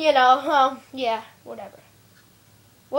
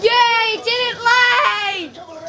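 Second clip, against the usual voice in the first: higher-pitched, drawn-out cheer; delighted cheer; 4 semitones higher, much slower than usual. Real, not acted. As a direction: celebrate with a long stretched last word. Cheering that game did not lag.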